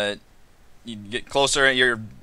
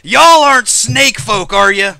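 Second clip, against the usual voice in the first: loud, high, drawn-out challenge; high country holler, questioning; suspicious rancher; 8 semitones higher, 10 dB louder, slower than usual. Acted. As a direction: call it out slowly as a wary lizard rancher. Lizard leader challenges the strangers.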